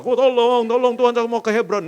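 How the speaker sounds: loud, high pleading shout; mock desperate cry to God. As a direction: yell to God for help. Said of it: "kita teriak-teriak".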